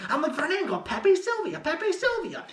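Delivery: high-pitched manic quote; crazed rant. Acted. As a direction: rant like a man gone crazy over a conspiracy. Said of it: "he's, like, went crazy".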